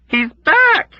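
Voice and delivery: very high, loud scream; loud terrified scream. Acted. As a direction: scream it as she collapses. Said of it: "Nurse Kramer screamed".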